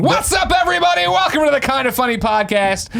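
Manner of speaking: announcer intro. Acted. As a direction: belt out the welcome like a host. Podcast intro shout.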